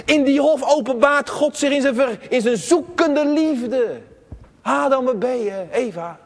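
full-force sermon delivery; preaching. Acted. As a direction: preach it out to the whole church.

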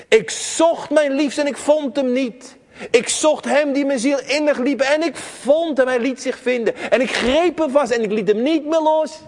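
full-force sermon delivery; preaching. Acted. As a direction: preach it out to the whole church.

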